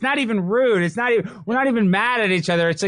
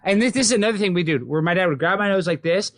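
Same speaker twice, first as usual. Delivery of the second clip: usual speaking voice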